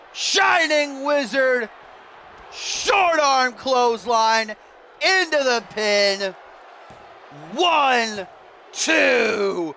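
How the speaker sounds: slow, stretched ringside count; big slow play-by-play call; drawn-out match call. Real, not acted. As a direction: draw each move and count right out. Calling finishing moves and pin count.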